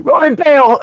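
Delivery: high-pitched comic panicked yelp; scared, mock panic. Scared of a fish man and bailing off the ship, mock panic. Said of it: "scared of a fish man".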